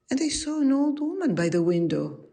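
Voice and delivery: usual speaking voice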